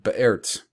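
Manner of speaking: slight gap after be in be'ert; be'ert with a break. Shown as a demonstration. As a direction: split be'ert before the root. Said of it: "That's why I started out by pronouncing the last word".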